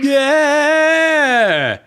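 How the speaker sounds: loud, high whoop of joy; excited cheer. Real, not acted. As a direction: shout it out, delighted. Cheering at the drawing.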